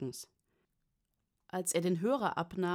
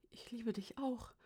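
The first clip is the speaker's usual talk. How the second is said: very soft, high-pitched reply; faint, tender old father; 5 semitones higher, 9 dB quieter than usual. A narrator's reading. Father answering his daughter on the phone, faint and tender. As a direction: answer lovingly in a small, high voice.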